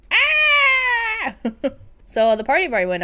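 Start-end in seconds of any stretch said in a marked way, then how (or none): 0.1-1.4 excited squeal. Acted. Shrieks seeing Angus.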